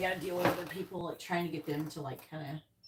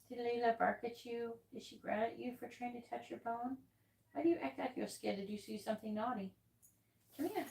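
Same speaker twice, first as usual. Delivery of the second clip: higher and softer, cooing pet voice; 5 semitones higher, 6 dB quieter than usual. Real, not acted. Owner soothing her scared dog, soft high coo. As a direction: gently coax a nervous puppy.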